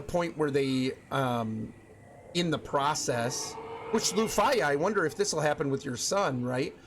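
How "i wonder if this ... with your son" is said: usual speaking voice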